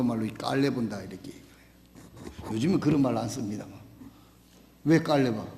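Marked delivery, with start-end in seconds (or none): none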